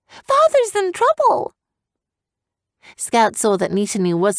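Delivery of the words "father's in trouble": high, slow frantic call; alarmed cry. A narrator's reading. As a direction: warn in panic. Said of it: "Evian cried".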